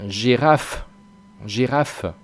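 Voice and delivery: girafe ending on a crisp F; clear final F. Shown as a demonstration. Teacher stressing the final F of girafe. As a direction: bite the F clearly. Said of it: "Ahí suena claramente la, la F".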